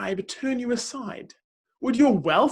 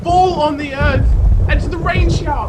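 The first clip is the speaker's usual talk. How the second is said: thunderous proclamation; 10 semitones higher, 15 dB louder than usual. Acted. Reader proclaiming God's roaring voice to the listener, full-force shouting. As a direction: preach it at full force, loud and high, shouting over the storm. Reading builds to the storm.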